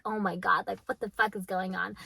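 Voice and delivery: low, hushed imitation of spooked men; understated, imitated alarm under the breath; mock scared guys. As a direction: quietly mimic guys who are mildly freaked out. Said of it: "like, scared".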